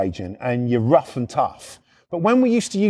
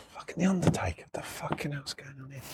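whispered, baffled inner thought; hushed grumble under your breath; very soft, puzzled self-talk; muttered disbelief; 2 semitones lower, 14 dB quieter than usual. Retold or imitated. Retold private thought, brooding.

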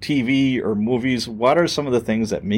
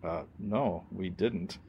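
much softer, lower admission; inner thought, hushed; 4 semitones lower, 14 dB quieter, slower than usual. Retold or imitated. Rookie realising nobody told detectives, hushed. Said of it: "I'm thinking to myself".

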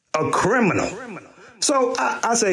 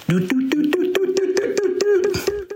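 higher, stretched ringtone hum; sung phone ring. Retold or imitated. Uncle mimicking his phone ringing at the party. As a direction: sing the ring climbing higher.

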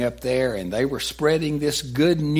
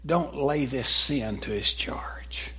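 slow whisper of a dying man; whispered prayer; 6 dB quieter, slower than usual. Retold or imitated. Stephen prays for his accuser in a slow whisper. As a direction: breathe it out as a whisper.

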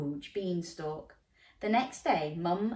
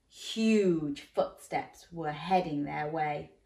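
ordinary delivery

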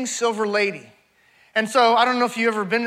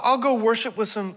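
normal talking voice

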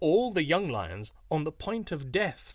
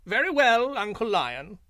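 high, loud fawning jackal voice; sly jackal; 8 semitones higher, 6 dB louder than usual. A narrator's reading. Jackal agrees to Uncle Lion, high and fawning. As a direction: play the crafty little jackal, all eager to please.

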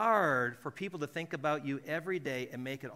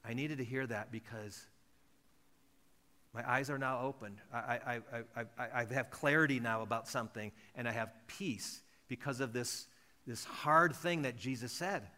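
quiet wonder; 6 semitones lower, 4 dB quieter than usual. Retold or imitated. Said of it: "others will go".

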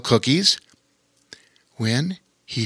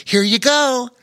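put-on high, bright little boy; young boy voice; 13 semitones higher, 4 dB louder than usual. A narrator's reading. Lore handing the spool to the otter, boyish high voice. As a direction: voice young Lore, eager and quick.

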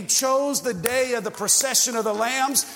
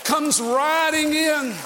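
preaching at full force; 4 semitones higher than usual. Real, not acted. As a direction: proclaim the Lamb to the crowd. Preacher building to climax.

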